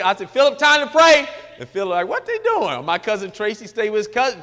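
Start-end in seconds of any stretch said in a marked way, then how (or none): 0.3-1.3 high, loud summons; calling out. Retold or imitated. Host summoning a houseguest to prayer, calling out. 2.1-2.8 high, squeaky puzzled voice; puzzled guest. Retold or imitated. Baffled houseguest asking about family prayer, puzzled.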